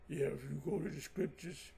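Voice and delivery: low, quiet boxer impression; Rocky Balboa. Mocking a rival debater to listeners, low Rocky Balboa impression. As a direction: mumble it softly like the movie boxer. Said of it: "talks like Rocky Balboa".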